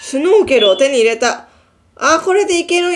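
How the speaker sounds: excited. Real, not acted. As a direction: read it out thrilled. Excited reading of item found.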